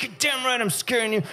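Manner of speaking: mock menacing. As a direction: gloat at the scared kid in a put-on voice. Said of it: "I'm like".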